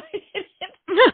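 high, loud burst of laughter; a real, loud, high laugh. Real, not acted. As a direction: break into a big giddy laugh. Laughing at running to Paul.

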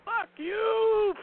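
high shouted taunt; mock taunt. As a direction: fling it at him with a rude gesture. Voicing character flipping the bird.

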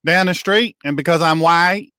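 hammed-up Southern yokel telling a tall tale; folksy Southern simpleton. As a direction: play a bewildered country bumpkin on the radio. Puts on folksy Southern character.

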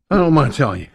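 ordinary talk, nothing marked

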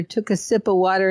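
usual speaking voice

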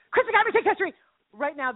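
quick excited run-through; rapid-fire repeat. Acted. Same title repeated faster.

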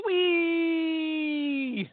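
long loud high squeal of wee; high drawn-out wee. Pretend. The Irishman in the joke squealing wee down the slide. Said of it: "he goes".

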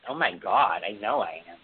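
normal talking voice

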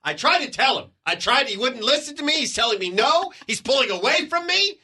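high-pitched, overwrought mock frustration; frantic wife. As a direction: imitate a mom who has totally lost it. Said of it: "sounds like a crazy woman".

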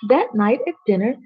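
plain reading voice

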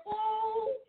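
high, thrilled little oh; delighted gasp. Real, not acted. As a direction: squeal with delight. Delighted reaction to God's words.

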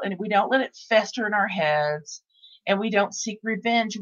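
ordinary delivery

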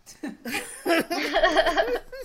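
loud high delighted laugh; hearty cackle; big laugh. Real, not acted. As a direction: burst out laughing. Laughs at drink talk.

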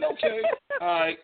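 high, stretched mock okay; mock resigned okay. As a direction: give in with a high sigh of okay.

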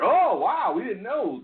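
high, sing-song mock innocence; feigned surprise. League pretending the video was news, fake surprise. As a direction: play dumb with a swooping "oh, wow".

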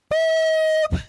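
very high, short boop; playful high-pitched boop; squeaky boop. Pretend. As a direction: pop out a high cartoon boop. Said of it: "Say it with me, Sister J".

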